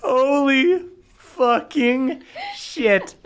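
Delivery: ordinary talk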